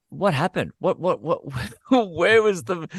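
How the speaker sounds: high, bewildered what-happened; confused comic, laughing at himself; baffled. Retold or imitated. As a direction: play the lost comic asking what went wrong. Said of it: "And you go".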